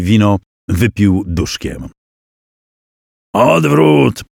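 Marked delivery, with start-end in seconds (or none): 3.3-4.2 barked command, higher and raised; battle command. Acted. Prince orders his knights to retreat, shouted command. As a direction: call the retreat across the field.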